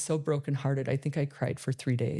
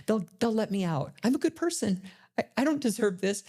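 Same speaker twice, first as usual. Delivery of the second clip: mild protest, pitched much higher; retold denial; 6 semitones higher than usual. Prisoner telling herself she'll be let out, mild denial. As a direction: lightly imitate your own disbelieving insistence, higher. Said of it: "I was in denial".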